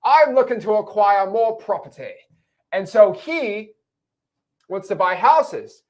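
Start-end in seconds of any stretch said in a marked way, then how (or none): 0.0-2.1 higher, pompous buyer voice; caricature cash buyer. Retold or imitated. Caricaturing the cash buyer for the class, higher and pompous. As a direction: ham up a stuffy investor. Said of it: "exaggerated caricature of what a cash buyer says".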